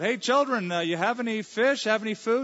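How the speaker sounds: acted-out hail, loud and high; calling out. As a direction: call out to people a way off. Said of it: "Calls out".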